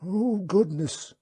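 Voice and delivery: slow, high creature's dismayed cry; wub cries out. Acted. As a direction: gasp it out like a shocked gentle beast. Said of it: "the wub cried".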